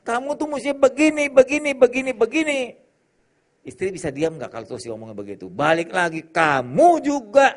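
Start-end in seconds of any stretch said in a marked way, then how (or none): 0.1-2.6 nagging spouse. Retold or imitated. Nagging demands quoted in sermon. 6.2-7.5 retorting spouse. Retold or imitated.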